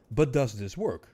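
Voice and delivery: normal talking voice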